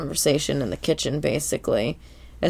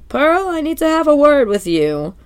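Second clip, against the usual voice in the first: high, loud, hammed-up official tone; serious voice; 10 semitones higher, 4 dB louder than usual. SpongeBob speaking at Krabs' door, hammed-up serious. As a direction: overplay the stern boss. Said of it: "serious voice".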